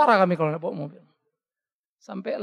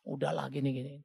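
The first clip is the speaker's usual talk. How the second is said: lower, soft calming "udahlah gini, gini"; soothing wife; 5 semitones lower, 10 dB quieter than usual. Retold or imitated. Wife calming her upset husband, soft and low. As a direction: settle him down gently, low and quiet. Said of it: "Istri saya nenangin".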